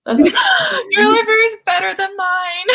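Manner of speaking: high, drawn-out mock wail; mock protest. Friend defends borrowing from another's library, playful high whine.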